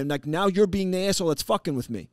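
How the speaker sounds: high-pitched heated rant, easing off from a shout; re-enacted scolding, loud at first and staying high; yelling. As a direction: start at a yell, then press the point home. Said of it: "I'm yelling at this kid".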